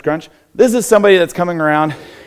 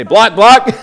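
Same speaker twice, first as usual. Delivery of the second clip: louder, higher chant to lead the class; loud call; 7 semitones higher, 8 dB louder than usual. Shown as a demonstration. Coach leading the class in a chant, loud and high. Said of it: "So everyone say".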